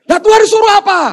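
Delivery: high, loud indignant question; exasperated yelling. Acted. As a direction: lay into the useless helper. Man demands God's message.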